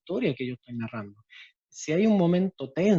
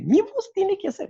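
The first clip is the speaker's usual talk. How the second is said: chick voice. Shown as a demonstration. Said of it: "mi voz no me sale muy acorde".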